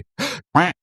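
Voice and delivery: fake alarmed gasp. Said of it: "I'd go".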